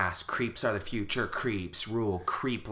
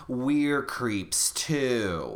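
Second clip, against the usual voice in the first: very slow, drawn-out creepy line; spooky reveal; much slower than usual. Acted. Parents reveal to Ricky they are creeps, very slow and eerie. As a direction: stretch every word like a horror twist.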